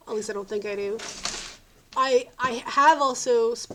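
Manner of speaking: ordinary talk, nothing marked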